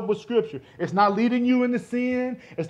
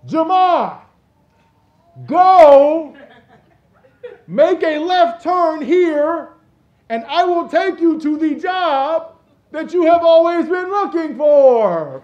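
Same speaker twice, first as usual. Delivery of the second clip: loud, high, slow and commanding; a mock booming heavenly command; booming voice of God; 7 semitones higher, 9 dB louder, slower than usual. Shown as a demonstration. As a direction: thunder it down like God from the sky. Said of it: "Let me show you real quick".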